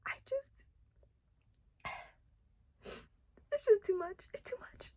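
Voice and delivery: breathy, worn-out whisper. Real, not acted. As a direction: whisper it, out of tears. Overwhelmed after crying.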